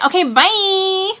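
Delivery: high, drawn-out sing-song farewell; exaggerated phone sign-off, high and slow; millennial bye. Shown as a demonstration. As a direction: stretch "bye" up high and hold it. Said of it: "that's a millennial thing when you say".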